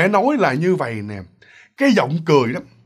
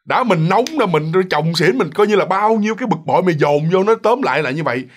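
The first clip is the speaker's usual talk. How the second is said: usual speaking voice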